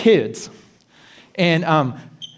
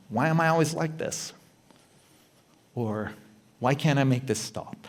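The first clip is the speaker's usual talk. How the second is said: soft, intimate self-questions; quiet inner critic; hushed inner voice; 2 semitones lower, 8 dB quieter than usual. Shown as a demonstration. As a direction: murmur them to yourself. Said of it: "Five words".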